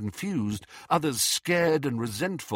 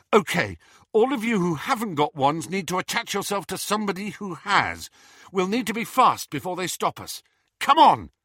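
raised, urgent leader's voice; brisk higher-pitched orders; Harry rallying the group; 8 semitones higher, 4 dB louder than usual. Acted. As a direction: marshal them, ending on a loud call.

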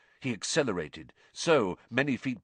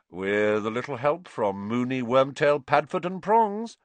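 usual speaking voice

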